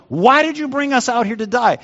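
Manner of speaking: high-pitched panicked complaint; mock yell of a crowd freaking out; acted yelling. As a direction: protest it high, as frantic Israelites. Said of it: "They just start yelling at Moses".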